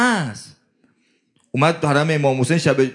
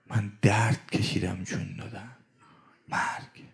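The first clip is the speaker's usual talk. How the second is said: whisper; 8 semitones lower, 10 dB quieter than usual. Acted. Mourning retelling of Moses' death.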